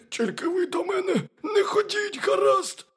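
very high, loud hare squeal; high, urgent little animal plea; pleading hare. A narrator's reading. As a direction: beg them in a squeaky hare voice.

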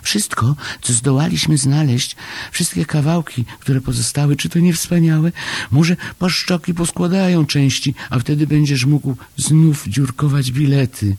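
high, fast, agitated small voice; excited little Chomek. A narrator's reading. Chomek telling Paszczak of the secret rescue, high excited rush. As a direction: pour it out high and breakneck.

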